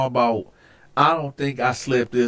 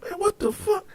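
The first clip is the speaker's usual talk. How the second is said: high, softer baffled reaction; mock bewilderment, pitched way up; startled disbelief; 7 dB quieter than usual. Retold startled reaction to smell.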